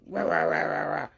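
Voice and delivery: imitated rah-rah growling rant; mocking "rah rah" babble; mock ranting noise. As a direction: garble an angry tirade as nonsense. Mimics the man's ranting noise.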